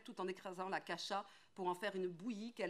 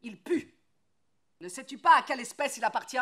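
loud, fast, much higher, spitting disgust; disgusted shout; 7 semitones higher, 18 dB louder than usual. Acted. Woodcutter shouting disgust at the foundling. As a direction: throw the words out in revulsion.

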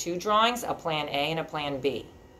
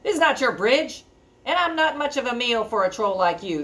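higher, louder goat protest; middle goat; 7 semitones higher, 4 dB louder than usual. Acted. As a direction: stand up to the troll. Middle goat stands up to troll.

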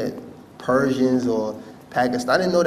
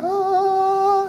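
long, high, held "aah"; high angelic note, drawn out; mimed heavenly ah; 4 dB louder than usual. Retold or imitated. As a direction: sing out a soul floating up to heaven. Mimes soul rising to heaven.

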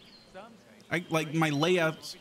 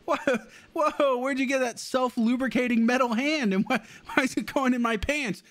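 put-on high, giggly nervous teen voice, much higher than his own; mocking Tom Holland's Spider-Man; 14 semitones higher than usual. Streamer mocking Spider-Man gushing to Tony Stark, high giggly voice. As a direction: play a quirky, starstruck kid hero. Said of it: "that was an impression of him".

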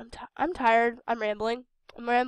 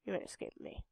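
menacing whisper; 4 semitones lower, 14 dB quieter, faster than usual. Real, not acted. Stalking bot in game.

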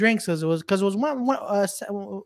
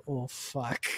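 dismayed producer; 7 semitones lower, 11 dB quieter than usual. Retold or imitated. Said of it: "Their producer's just like".

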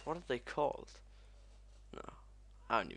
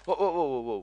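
high, loud burst of whoas; alarmed whoas; 5 semitones higher, 9 dB louder, faster than usual. Real, not acted. Gamer losing arms in game, alarmed cries. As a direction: rattle them out in alarm.

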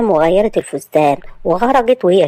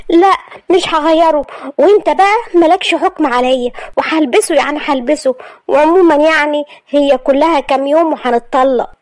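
put-on high, stubborn girlish refusal; defiant girl; 8 semitones higher than usual. Maria refusing Asad to provoke him, high and defiant.

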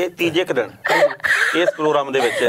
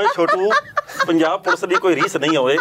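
Punjab Police film dialogue; 2 semitones higher than usual. Acted. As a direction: bark the film line out through laughter. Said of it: "ਉਹ ਪੂਰਾ ਜਿਹੜਾ dialogue ਆ". (ਹੱਸਦਿਆਂ police ਵਾਲਾ dialogue)

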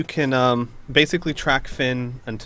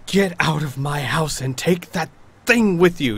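louder, indignant order to leave; outraged baroness; 4 dB louder than usual. Acted. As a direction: throw them out, wide-eyed and furious. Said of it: "she's wide-eyed and she goes".

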